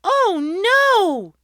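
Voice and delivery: very high, loud panicked cry; alarmed cry. A narrator's reading. Mother realising the baby is gone, high and panicked. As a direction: gasp in sudden fear. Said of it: "Mother cried".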